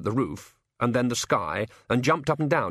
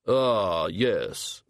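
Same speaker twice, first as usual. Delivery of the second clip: a big man reading a list; Hogfather voice; 2 semitones lower than usual. Acted. Hogfather figure speaking.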